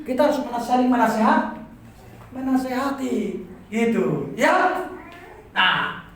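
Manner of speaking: ordinary talk, nothing marked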